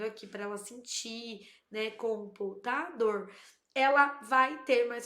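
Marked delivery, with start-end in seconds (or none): 1.9-3.3 "computador" slowly in beats, com-pu-ta-dor; slow clap-along split of "computador"; syllable by syllable. Shown as a demonstration. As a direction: segment "computador" piece by piece. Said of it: "brinco na oralidade de pedacinhos das palavras".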